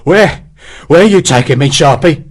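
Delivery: panting. Acted. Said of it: "Hexwell panted".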